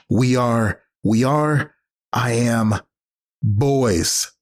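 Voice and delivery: ordinary talk, nothing marked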